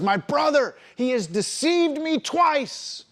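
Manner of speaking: high-pitched, drawn-out indignation; frustrated, upset. Acted. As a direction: protest the betrayal, pitched high and slow. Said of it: "began being frustrated and began to become upset".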